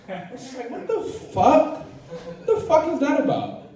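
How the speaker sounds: high, slow, laughing disbelief; incredulous outburst. Real, not acted. To the crowd about his mom's comment, a laughing high-pitched what the fuck. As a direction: pitch leaps up in stunned laughing outrage.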